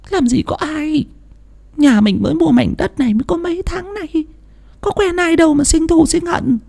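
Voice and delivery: high, irritated wife voice; annoyed wife. A narrator's reading. Wife annoyed at husband's idea of an enemy, high and irritated. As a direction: snap back at him, high and cross. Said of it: "Bà Thủy bực dọc".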